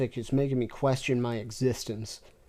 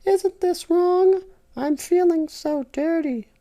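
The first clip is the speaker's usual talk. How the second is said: loud falsetto moan; 4 dB louder than usual. Acted. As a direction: call him out in a moaning falsetto. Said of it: "moaned loudly Lala".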